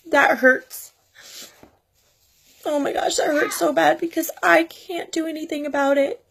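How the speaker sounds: high, tearful voice breaking through the words; heartbroken, crying while speaking to viewers. Real, not acted. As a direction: talk through real tears, voice pinched high. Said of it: "I'm sorry for crying".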